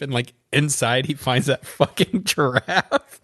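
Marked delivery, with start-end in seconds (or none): none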